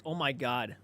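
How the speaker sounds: ordinary talk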